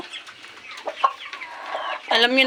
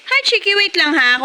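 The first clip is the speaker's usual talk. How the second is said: cooing to a pet; 6 semitones higher than usual. Real, not acted. Cooing to her chicken.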